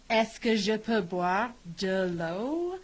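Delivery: put-on French question with a Valley Girl lilt. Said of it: "sounded like a tad Valley Girl".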